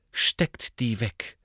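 slow, cold command; icy and unhurried; coolly ordering the boys. A narrator's reading. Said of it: "sagte sie kühl".

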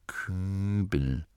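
soft, breathed single word; gehaucht. A narrator's reading. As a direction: breathe it out quietly. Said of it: "Gurda hauchte ihren Namen". (leise hauchend)

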